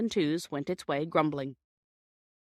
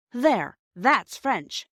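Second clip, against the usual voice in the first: triumphant; 4 semitones higher, 6 dB louder, much slower than usual. Acted. Triumphant boy shows the crowd.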